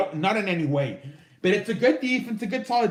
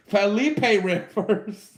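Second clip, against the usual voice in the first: slow, laughing repeat of the name; uncle's nickname voice; much slower than usual. Retold or imitated. Host mocking his uncle's name for Philip, laughing imitation. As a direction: stretch the name out through laughter. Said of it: "as my uncle calls him".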